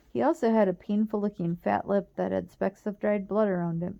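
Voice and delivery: usual speaking voice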